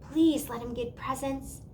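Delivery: plain reading voice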